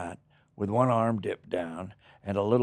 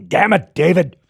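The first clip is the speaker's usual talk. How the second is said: high, loud, raspy snarl of a curse; sudden Freddy snarl at the makeup artist; 9 semitones higher, 12 dB louder than usual. Retold or imitated. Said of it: "it just stuck in my throat".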